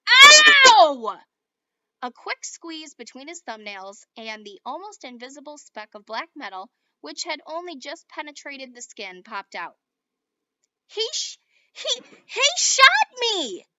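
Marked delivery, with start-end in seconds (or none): 0.1-1.2 high, very loud yelp; pained cry. A narrator's reading. Squeezing out the bullet. 10.9-13.7 high, loud, slow stammer of shock. A narrator's reading. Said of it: "in a shocked voice".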